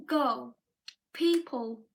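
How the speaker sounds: usual speaking voice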